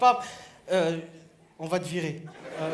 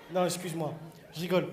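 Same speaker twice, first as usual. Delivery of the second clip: ordinary delivery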